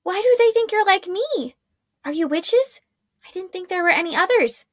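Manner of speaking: put-on a worried young witch; young woman Arella. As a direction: plead for answers. Arella questions women.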